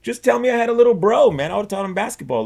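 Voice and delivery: high, pleading protest starting loud; acted exasperated plea. Guest retells pleading with parents about a secret brother, exasperated. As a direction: whine the complaint comically.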